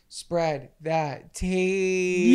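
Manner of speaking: a slow sung-out signature sign-off; drawn-out sign-off. Acted. Host drawls the show's catchphrase to the campers, slow sign-off. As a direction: stretch spread that tea out slowly as a catchphrase. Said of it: "always remember".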